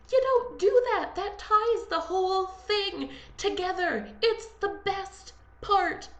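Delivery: high-pitched, exasperated scolding; indignant protest. Real, not acted. Titanic fan scolding people who leave early, indignant.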